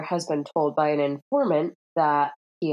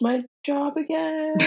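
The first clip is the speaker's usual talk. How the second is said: high confused voice; 11 semitones higher than usual. Retold or imitated. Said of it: "he's like".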